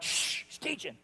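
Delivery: very soft shushing; hushing whisper. Retold or imitated. Bystanders shushing friends so the teacher isn't interrupted. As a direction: hush someone in a crowd. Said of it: "people are like".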